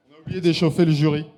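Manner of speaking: loud call to the jury; rally the jury, loud. Real, not acted. Host calling out to warm up the jury, loud.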